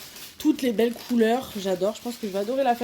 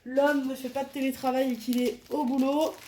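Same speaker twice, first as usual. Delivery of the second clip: ordinary talk, nothing marked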